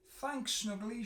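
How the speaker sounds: high, loud grateful cry; cried out thanks. A narrator's reading. Stripey thanking Snuggly after the hug, a high cry. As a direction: call out thanks brightly. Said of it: "she cried".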